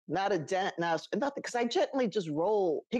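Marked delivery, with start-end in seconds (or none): none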